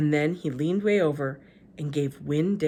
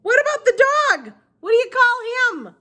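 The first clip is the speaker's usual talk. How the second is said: put-on high, far-off old woman voice; Gloria Dump voice; 9 dB louder than usual. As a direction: pitch her up as an old lady.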